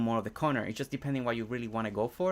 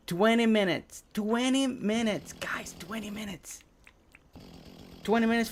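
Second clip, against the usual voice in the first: excited countdown call; 9 semitones higher, 4 dB louder than usual. Real, not acted. Streamer calling twenty minutes to the chat, high and excited. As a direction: call it out high to everyone watching.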